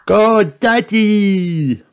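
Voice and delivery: very high, slow drawn-out cheer; excited cheer. Acted. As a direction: cheer him on with glee. Children cheering Daddy on.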